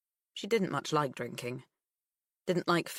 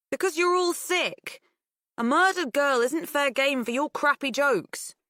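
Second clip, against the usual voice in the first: angry; 10 semitones higher, 6 dB louder than usual. Acted. Pip upset arguing.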